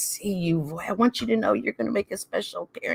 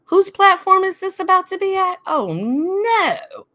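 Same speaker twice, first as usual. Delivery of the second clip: shocked; 11 semitones higher, 5 dB louder than usual. Real, not acted. Shocked realization about the host.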